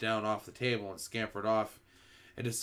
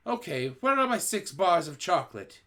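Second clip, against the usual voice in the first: a hint of a demanding boy; 6 semitones higher, 5 dB louder than usual. A narrator's reading. Bruno demanding his chocolate.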